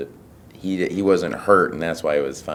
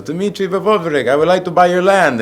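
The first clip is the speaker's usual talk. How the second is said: much higher, louder comic voice; buyer in the film; 11 dB louder than usual. Retold or imitated. A buyer asks the father for his land, high and loud. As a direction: pitch it way up as the eager buyer. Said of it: "the guy goes".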